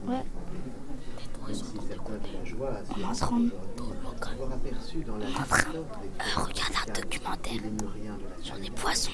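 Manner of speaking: hushed whisper. Real, not acted. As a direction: whisper it so nobody hears. Sneaking quietly into the room.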